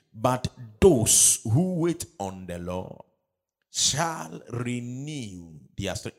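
loud, slow, drawn-out verse reading; emphatic scripture reading. Acted. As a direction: lead the congregation with stretched key words. Leads congregation reading scripture aloud.

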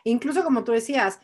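ordinary delivery

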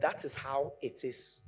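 slow, quiet, matter-of-fact reply; professor's settled answer. Retold or imitated. Preacher quoting his professor's answer, slow and soft. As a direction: answer softly and slowly, closing the matter. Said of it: "He said".

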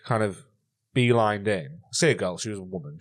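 ordinary talk

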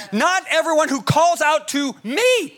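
slow build to a high, emphatic "me"; stress on me. Retold or imitated. Preacher quoting Jesus to the congregation, stressing "me". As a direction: lean hard on "me" at the end. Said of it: "Me, Jesus Christ".

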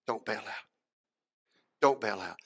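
low, soft, spaced-out plea; hushed, earnest urging; quiet exhortation. Retold or imitated. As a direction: press it home gently, with pauses. Jesus's plea, quietly repeated.

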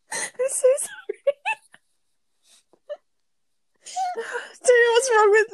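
helpless high laughter through the words; can't stop laughing while apologising; laughing fit. Real, not acted. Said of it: "while [name] catches her breath".

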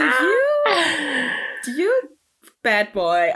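high, drawn-out first word, then dropping; teasing, exaggerated disbelief; mock scandalised. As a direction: draw it out in playful mock outrage. Retells her scandalised inner reaction.

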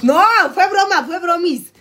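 high, loud playful denial; loud, high-pitched protest; joking backtrack. Real, not acted. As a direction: blurt out that it's a joke. Loudly backtracks after reading a comment.

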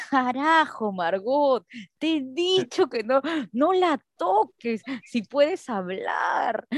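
slow, drawn-out exasperated telling-off; stern scolding father. Retold or imitated. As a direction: stretch each word in mock reproach. Acting father scolding mother.

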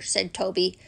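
plain reading voice

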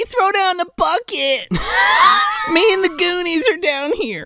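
high voice calling from below; calling up the well. Retold or imitated. Andy calling up the well, high voice. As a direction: shout up from the bottom of a well. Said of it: "and she's like".

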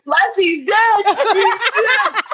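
high, laughing mock wail of a crying child; mock sobbing. Little girl crying that Lassie is dead, high and laughing. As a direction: ham up a kid sobbing over a dead dog. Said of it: "I'm crying".

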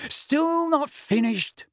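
high, slow motherly query; mother checking in. A narrator's reading. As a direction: peek in and ask slowly.

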